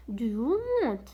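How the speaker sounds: slow, careful repetition of Do you want; slow teacher demo; slow model phrase. Shown as a demonstration. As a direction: say each word slowly and clearly. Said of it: "هذا سؤال مهم".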